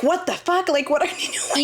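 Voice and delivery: high, outraged retold yell; mock outrage. Woman retelling finding men on her machine, mock outraged. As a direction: act appalled at the men. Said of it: "I was just like".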